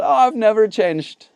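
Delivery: playful mock of the stubborn new dad. Mimicking [name]'s denial.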